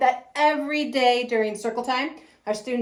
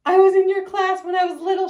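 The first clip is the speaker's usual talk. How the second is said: high, loud, gushing imitation; excited student; 9 semitones higher, 6 dB louder than usual. Former student greets her preschool teacher, excited.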